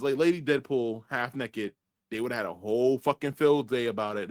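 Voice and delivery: ordinary talk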